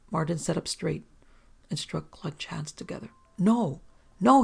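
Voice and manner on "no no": higher, louder desperate denial; desperately. Acted. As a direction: plead it, frightened. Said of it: "he said desperately".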